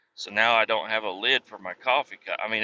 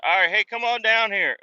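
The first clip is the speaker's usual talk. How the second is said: higher, louder, bossy mock instructor call; 10 semitones higher, 3 dB louder than usual. Imitating the instructor ordering him back down, higher and louder.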